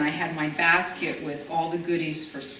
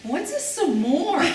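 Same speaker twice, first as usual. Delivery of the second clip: puzzled question; 11 semitones higher, much slower than usual. Retold or imitated. Bud and Jeanette puzzled.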